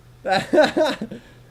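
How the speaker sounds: high, loud burst of laughter; real cracked-up laugh. Real, not acted. Laughs at the coincidence.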